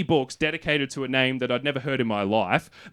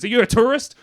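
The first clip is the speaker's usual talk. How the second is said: surprised; 11 semitones higher, 8 dB louder than usual. Retold or imitated. Worker surprised.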